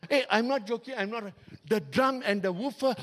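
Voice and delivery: usual speaking voice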